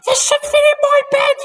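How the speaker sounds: very high, loud and fast shrieking; panicked scream. A narrator's reading. Wife bouncing around in bed, high panicked shriek. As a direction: screech in panic. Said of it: "screamed Missus Twit".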